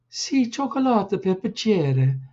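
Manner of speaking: high, chirpy little voice; little cousin chiming in, chirpy; chirping little boy. Acted. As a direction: pipe up like a small bird. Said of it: "chirped in Giuseppe".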